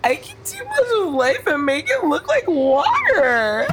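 slow, high tearful wail; drunken crying. Acted. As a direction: cry the words out, tipsy and slow. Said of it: "then she starts to cry".

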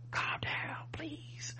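imitating an urgent hushed library whisper; very soft, voiceless mock plea. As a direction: whisper it, trying to calm someone down. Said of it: "it turns into a whisper fight".

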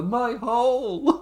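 high, slow drawn-out mock wail; imitated dramatic cry. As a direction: draw it out in comic agony. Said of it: "the delivery of the".

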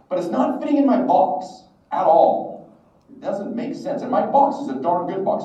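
high, emphatic self-mocking complaint; indignant protest. Young man defends his plan to God, indignant. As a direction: plead your case in mock indignation.

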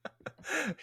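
squeaky burst of laughter; high-pitched giggle. Real, not acted. Listener laughs at the quote.

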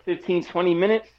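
ordinary talk, nothing marked

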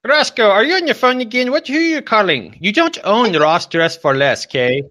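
high, loud put-on mother voice; scolding mom. Mom catching Roscoe prank-calling a store, mock scolding. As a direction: tell the kid off like a cross mom. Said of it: "I'm gonna get my mom".

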